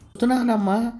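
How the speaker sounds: son calls out loudly in answer, pitched well up; calling back. A narrator's reading. Son answering his old mother's call on the bus, calling out loudly. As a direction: shout it across the bus so mother hears.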